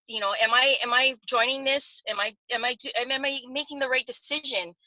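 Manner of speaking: ordinary talk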